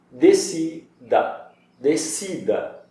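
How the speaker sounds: decida drawn out slowly with the DI stressed; de-CI-da, slow and syllable by syllable. Shown as a demonstration. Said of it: "Vida, I, forte, A".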